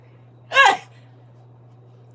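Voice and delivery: high, loud crying laugh; tearful laugh. Real, not acted. Teacher overwhelmed at graduation, high loud crying laugh. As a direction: laugh through tears.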